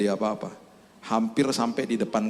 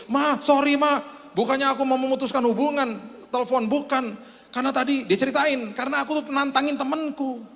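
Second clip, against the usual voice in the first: high, breathless excited voice; amazed son; 14 semitones higher than usual. Acted. Atheist son, calling his mother back in amazement. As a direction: pour it out in amazement.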